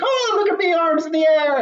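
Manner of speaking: high, showy Grinch voice; mock Grinch flailing. [name] mocking the Grinch waving his arms, high and showy. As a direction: ham up the Grinch showing off. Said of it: "He's, like, over-exaggerating his arms".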